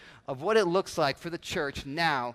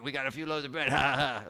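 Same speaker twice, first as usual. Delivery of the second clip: mock joking; 4 semitones lower, 3 dB quieter than usual. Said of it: "I'd imagine it's like a joke".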